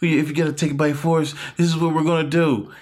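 rally the crowd in a mock politician's voice; Donald Trump. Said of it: "That's a great Trump accent".

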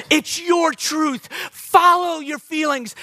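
mock the self-help line; mock slogan. Preacher mocking culture's advice to the church, slow and sarcastic. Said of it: "the concept in our culture".

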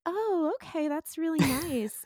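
high-pitched nicey-nice act; sweet tweaked voice. Shown as a demonstration. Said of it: "if I'm talking one way".

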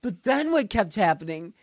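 ordinary delivery

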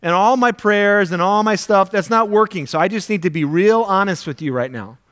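loud, urgent demand at God; exasperated plea. Retold or imitated. Praying person snapping at God for help, loud and urgent. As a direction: push it like time's run out.

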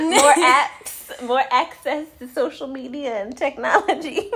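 high, loud, laughing; amused, piling on the joke; laughing tease. Real, not acted. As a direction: tease through your laughter. Teasing along with child's joke, laughing.

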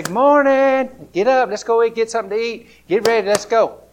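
put-on high, loud, cheerful parent rousing a kid; excited wake-up call. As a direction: burst in bright and loud to get them up. Parents waking child for the trip.

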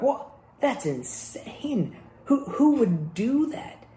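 high-pitched, drawn-out disbelief; incredulous. Acted. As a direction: act appalled, stretching the key word. Acting out listeners' shocked reaction.

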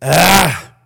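throaty demon's "ah"; demonic guttural. Acted. Preacher voicing the demon confronting Jesus, demonic guttural. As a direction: give a guttural cry like a demon. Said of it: "sort of the demonic guttural".

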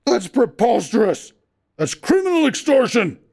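high, loud outrage; outraged. A narrator's reading. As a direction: protest the extortion. Clagett outraged.

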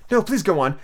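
understated apology; very humble. Acted. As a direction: ask meekly. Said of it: "said very humbly".

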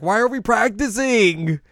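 loud, high, drawn-out mock outburst; freaking out. Azusa demanding answers from the club, freaking out. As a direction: act out a frantic newcomer losing it. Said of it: "like, freaks out".

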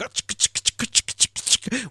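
whispered shaka shaka rhythm; shaka beat imitation. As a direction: tap the rhythm out in breathy syllables. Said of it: "it's just going".

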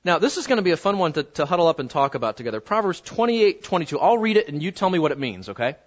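ordinary delivery

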